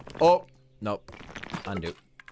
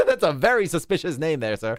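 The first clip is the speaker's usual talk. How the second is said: laughing; 7 semitones higher, 9 dB louder than usual. Real, not acted. Teasing a subscriber's name.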